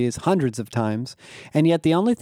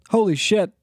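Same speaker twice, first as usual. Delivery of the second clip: scared; 8 semitones higher than usual. Acted. Scared by alien in room.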